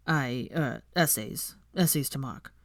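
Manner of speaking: low, halting nervous stammer; flustered excuse, escape fast. Acted. Draco fleeing the table, low nervous stammer. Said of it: "he stammered".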